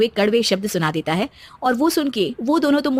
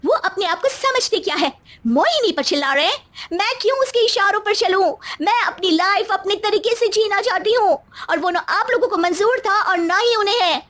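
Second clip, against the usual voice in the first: high, upset complaining; indignant spoilt daughter; 10 semitones higher than usual. Acted. Daughter complaining to her parents, high and indignant. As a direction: protest that nobody lets you live.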